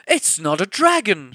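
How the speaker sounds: loud high shout. Acted. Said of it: "shouted the third".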